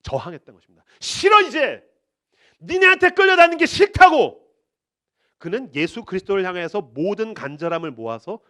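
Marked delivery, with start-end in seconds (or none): 1.0-4.4 loud high defiant yell; furious refusal, much louder; defiant shout. Acted. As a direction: break free with a shout. Beggar refusing the world.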